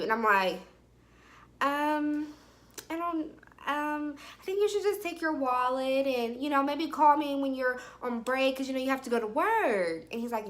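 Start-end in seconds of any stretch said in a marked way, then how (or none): 1.6-10.0 hesitant stalling. Retold or imitated. Woman avoiding getting in his car, hesitant. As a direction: stall politely, feeling for a way out.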